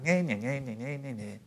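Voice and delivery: soft nasal nyeh-nyeh of a nagging critic; mocking nagging sound. Shown as a demonstration. Coach mimicking the critical inner voice, mocking nyeh. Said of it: "that critical self-talk".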